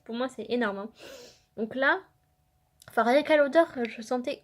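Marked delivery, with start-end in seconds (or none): none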